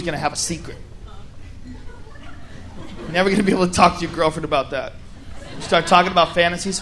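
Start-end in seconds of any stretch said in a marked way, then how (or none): none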